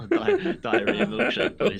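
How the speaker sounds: high, slow, words breaking up with laughter; laughing through words. Real, not acted. Host topping a gross joke with his co-hosts, helpless laughter. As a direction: barely get the joke out for laughing. Jokes while still cracking up.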